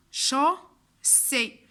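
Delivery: "chaussée" slowly split as cho, sé; teacher sounding out "chaussée" in two parts; syllable-by-syllable model. Shown as a demonstration. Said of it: "on va dire chaussée".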